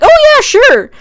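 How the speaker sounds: very high, loud, chirpy yes; perky agreement. Retold or imitated. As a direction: imitate over-eager girls agreeing. Acting out girls' keen agreement.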